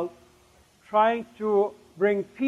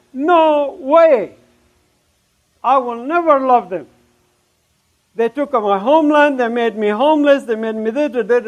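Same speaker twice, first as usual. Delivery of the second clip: loud, high-pitched retold outburst; screamed refusal; 4 semitones higher, 7 dB louder than usual. Refusing the Lord's command to love enemies, screamed protest. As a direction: cry it out in defiant protest. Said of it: "I screamed and I said".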